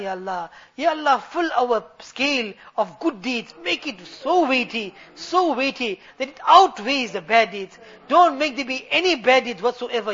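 earnest supplication that ebbs and swells; heartfelt pulpit prayer with emphatic calls; fervent preaching. Real, not acted. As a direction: plead to Allah on the congregation's behalf. Preaches throughout.

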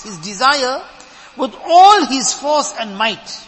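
loud, slow, emphatic sermon delivery; forceful preaching. Real, not acted. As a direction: drive each phrase home slowly and loudly.

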